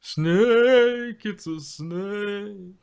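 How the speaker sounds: high then slow alarmed; panicked meme voice. Retold or imitated. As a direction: shout snake, then draw it out. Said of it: "old internet thing".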